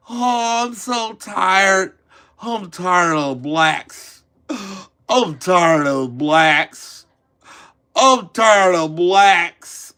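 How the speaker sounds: slow, drawn-out fake exhaustion; mock weary sigh. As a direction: drag every word like you're worn out.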